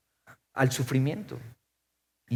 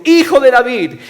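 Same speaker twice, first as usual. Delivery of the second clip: loud, raised plea; crying out; 7 semitones higher, 8 dB louder than usual. Acted. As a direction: call out to be heard. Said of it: "gritando y diciendo".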